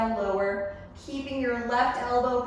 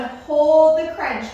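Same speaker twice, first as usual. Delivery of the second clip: drawn-out, loud call to hold; loud coaching cue; 6 semitones higher, 11 dB louder, slower than usual. Real, not acted. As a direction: push them to hold, drawn out. Coach cues hold in workout.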